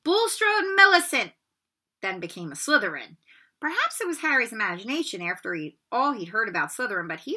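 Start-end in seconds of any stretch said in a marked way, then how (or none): 0.0-1.3 calling out names. Acted.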